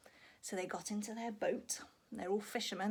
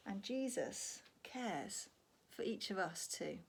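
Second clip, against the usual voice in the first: tender closing; 4 semitones lower, 6 dB quieter than usual. Real, not acted. Teacher giving the gentle lesson to children, soft and sincere.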